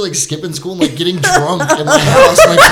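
normal talking voice

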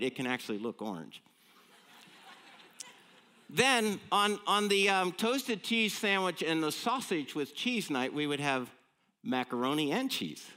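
ordinary talk, nothing marked

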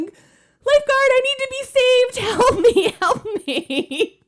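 high, loud hammed-up panic; mock panicked cry for help. Retelling her triathlon swim, a hammed-up panicked yell to the lifeguard. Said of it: "a massive panic attack".

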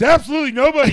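high loud promo line; loud wrestling promo. Retold or imitated. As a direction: bellow it like a wrestling heel.

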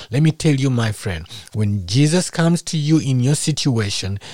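ordinary talk, nothing marked

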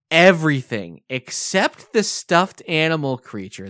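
ordinary talk

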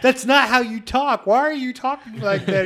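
high, loud, slow scolding mother; scolding mom. Retold or imitated. Family in the sketch scolds the son, loud high mom voice. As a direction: nag him to stop that accent.

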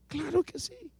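ordinary talk

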